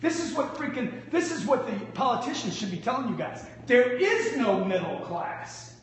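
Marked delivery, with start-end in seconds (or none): 3.6-5.8 slow emphatic. Real, not acted.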